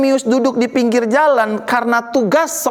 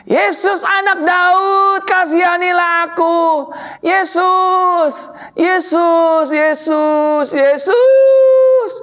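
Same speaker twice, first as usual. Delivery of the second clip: high, drawn-out repeated calls; Bartimeus shouting; 8 semitones higher, 3 dB louder, much slower than usual. Acted. Blind beggar calls after Yesus for mercy, shouting desperately. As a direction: keep calling out, climbing to a last piercing yell. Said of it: "Dia teriak".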